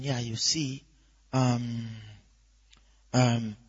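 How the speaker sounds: low, soft, hesitant chat; talking under voice. Shown as a demonstration. Preacher shows talking while thinking of something, low absent murmur. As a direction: murmur absently with long ums. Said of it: "when you're talking under the voice".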